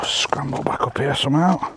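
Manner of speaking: ordinary talk, nothing marked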